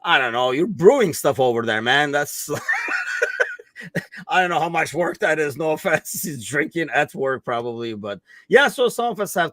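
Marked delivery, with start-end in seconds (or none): 2.6-4.2 high shouted laughter; big high laugh. Real, not acted. Laughs teasing about brewing.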